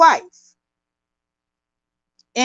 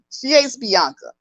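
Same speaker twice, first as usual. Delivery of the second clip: usual speaking voice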